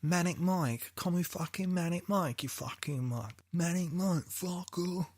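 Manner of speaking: playful mock cockney hard man; cockney accent. Host playing a cockney tough guy for his co-host, light cockney. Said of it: "This is my new accent now".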